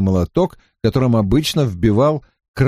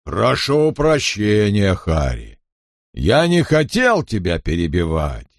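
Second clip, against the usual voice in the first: calm burly blacksmith; 3 semitones lower than usual. A narrator's reading. Big blacksmith answers the silenced Harri. (низкий неторопливый голос здоровяка)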